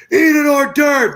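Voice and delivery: put-on loud, high-pitched drunken yell; imitated drunk scream. Friend imitating drunk Joey at a party, screaming. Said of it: "screams at me".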